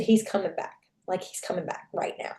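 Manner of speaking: urgent whispered warning; hushed, frightened whisper into the phone. Retold or imitated. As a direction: breathe it out so he can't hear. Hiding, reporting the attacker returning.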